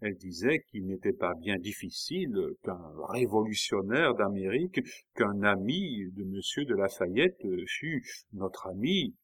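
usual speaking voice

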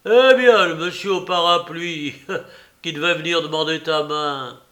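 teasing, with a laugh in the voice; mocking. A narrator's reading. Old woman taunting her goddaughter, narquois and laughing. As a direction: needle her with a sly laugh. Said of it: "dit d'un air narquois".

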